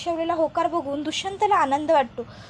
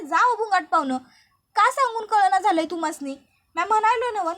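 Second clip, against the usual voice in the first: higher, louder angry retort; 5 semitones higher, 6 dB louder than usual. Acted. Krishna ordering Dushyant to leave, angry and loud. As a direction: drive him out, fed up.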